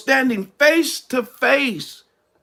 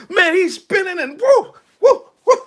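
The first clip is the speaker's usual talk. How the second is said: high, loud rising whoops; excited whoops; 8 semitones higher, 3 dB louder than usual. Real, not acted. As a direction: whoop it up as he spins away. Excited description of dodging.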